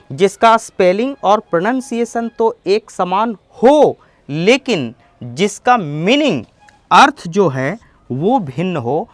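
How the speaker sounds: ordinary talk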